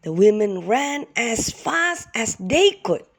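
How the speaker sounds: ordinary delivery, nothing marked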